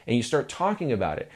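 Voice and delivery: normal talking voice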